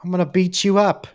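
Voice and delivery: high-pitched British tough-guy parody; mock British accent. Imitating a British guy threatening his life, high mock British accent. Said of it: "If a British guy came up to me".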